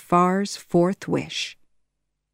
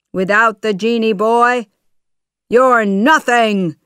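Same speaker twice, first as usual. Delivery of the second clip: louder villain sneer; sneering shout; 4 semitones higher, 6 dB louder than usual. Acted. Jafar shouting down at trapped Aladdin, sneering. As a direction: crush the boy with scorn. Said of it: "he shouted".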